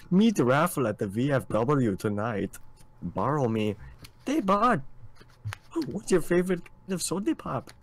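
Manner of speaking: put-on Minnesotan accent. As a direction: play a chipper Minnesota local, pitch swooping. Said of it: "miss the Minnesotan stuff".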